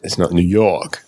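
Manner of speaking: New York said in an imitated New York accent; put-on New York accent. Shown as a demonstration. Host contrasts it with Jersey, imitated New York accent. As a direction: try a local's New York pronunciation. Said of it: "I've been corrected because it's not really New York".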